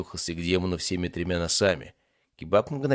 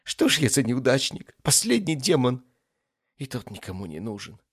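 sobbing self-pity; 6 semitones higher, 3 dB louder than usual. Acted. Last demon feels nobody needs him, self-pitying. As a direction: bewail your luck, then fade. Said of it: "всхлипнул он".

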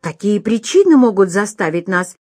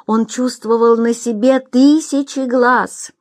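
usual speaking voice

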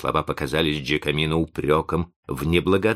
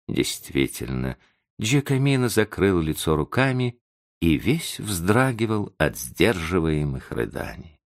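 plain reading voice

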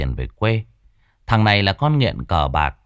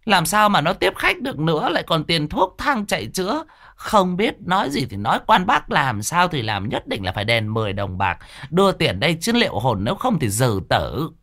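higher-pitched, pushy shopkeeper demanding money; 7 semitones higher than usual. Acted. Shopkeeper threatening the three men over money, pushy and demanding. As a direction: press them hard for the ten silver coins.

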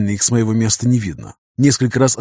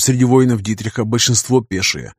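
ordinary delivery

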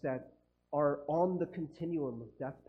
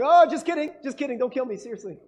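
high, loud, frantic plea; mock terror, backpedalling fast; panicked begging; 6 semitones higher, 12 dB louder, faster than usual. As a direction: beg for your life in a hammed-up panic. Disciples begging for their lives.

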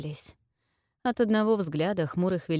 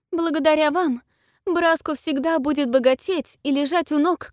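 cartoon princess; 8 semitones higher, 7 dB louder than usual. Princess gushes thanks to king.

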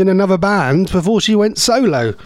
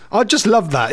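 ordinary talk, nothing marked

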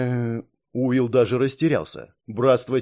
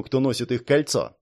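plain reading voice

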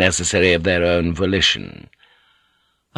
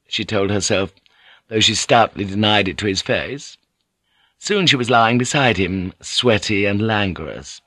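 plain reading voice

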